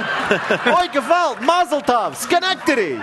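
high loud hammed-up Yiddish exclamations; mock Yiddish New Yorker, overdone; yiddish accent. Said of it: "Ford's response".

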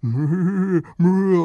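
loud mock moose moo; drawn-out mooing. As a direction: moo long to scare the loggers. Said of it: "he started, Moo".

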